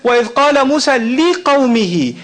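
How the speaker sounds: fast chanted Arabic recitation; Quran recitation. Shown as a demonstration. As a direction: recite the verse in a flowing chant. Quoting Quran verse in Arabic.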